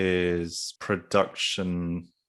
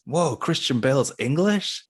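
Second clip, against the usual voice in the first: higher, slow, drawn-out surprise; mock astonishment; 7 semitones higher, 4 dB louder than usual. Host quoting comments on movie trailers, mock astonished. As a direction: imitate an amazed online commenter.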